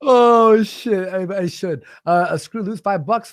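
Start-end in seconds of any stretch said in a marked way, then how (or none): none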